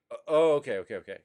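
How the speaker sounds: sudden loud oh, then settling okays; dawning understanding. Retold or imitated. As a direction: catch on halfway through. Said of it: "you would go".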